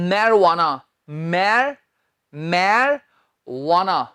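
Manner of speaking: marijuana stretched very slowly as mair-wana; slow careful repeat. Shown as a demonstration. Teacher repeating the word slowly for students to copy. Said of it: "मैं फिर बोलता हूं".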